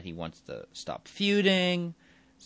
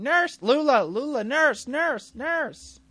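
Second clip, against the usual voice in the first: very high, loud frantic cries for the nurse; hammed-up panicked calls for the nurse; panicked calling; 9 dB louder, much slower than usual. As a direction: shriek for help in a mock panic. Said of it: "Brenda's there going".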